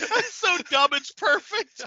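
high, laughter-filled talk; laughing hard. Real, not acted. Reacting to the joke, real laughter. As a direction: crack up through the words. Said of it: "I should not have been drinking my coffee".

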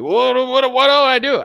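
high, loud flustered what do I do; panicked fan. Retold or imitated. Mocking a fan who has been checkmated, high and loud panic.

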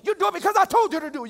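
fast, high, hammed-up yell; loud mock parental outburst; exasperated parent. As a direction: lay down the law to a child at full volume. Voices parent laying down law.

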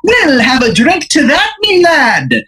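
high, hammed-up bad Irish accent; Izzy Irish. Leprechaun-dressed man offers a drink to his new pal, bad Irish accent. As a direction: propose a drink in a terrible high Irish brogue. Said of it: "his accent is horrendous".

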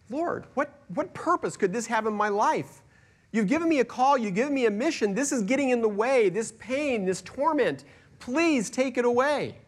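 pleading with God. Retold or imitated. Paul pleading with God to remove his torment, high and anguished. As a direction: beg God to take the pain away. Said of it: "I pleaded".

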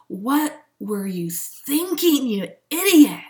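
hammed-up exasperation at yourself; mock self-scolding. Scolding herself in own head.

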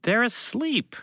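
high-pitched, talking down; condescending alien reply. Acted. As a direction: answer as if it were obvious. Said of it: "the creature responded condescendingly".

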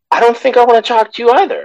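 high, fast brush-off; dismissive. Real, not acted. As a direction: toss it off breezily. Dismissive punchline.

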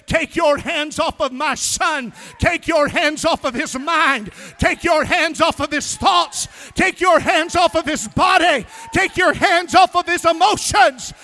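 building from firm command to full shouted rebuke. Retold or imitated. God rebuking the enemy off his son, rising shout. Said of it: "God that I serve by his mighty power said".

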